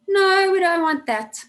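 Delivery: sudden loud, high no; lively burst. Real, not acted. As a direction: break out of the drone loudly. Teacher breaks the monotone demo.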